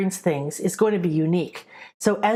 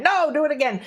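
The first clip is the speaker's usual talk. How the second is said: strict teacher retry; 7 semitones higher than usual. Retold or imitated. Teacher sending the apprentice back to redo the test, insistent. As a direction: order "No, do it again" up high and firm. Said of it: "she would be like".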